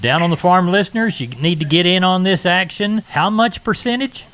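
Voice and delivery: radio ad read, pumped up; holiday sale ad. Acted. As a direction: sell it like a hyped radio spot. Host hypes the sale.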